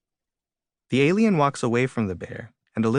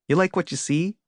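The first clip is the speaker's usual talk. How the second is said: higher, teasing come-on; flirty show-off; 7 semitones higher than usual. A narrator's reading. Flower showing off to swooning bees, flirty. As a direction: flaunt it, pitch climbing at the end.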